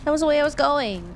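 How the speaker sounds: exasperated outburst. Real, not acted. Loud fast exasperated.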